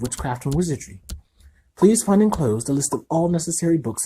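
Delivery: high, formal reading voice; boy reading his acceptance letter aloud. Acted. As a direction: read it like an official letter.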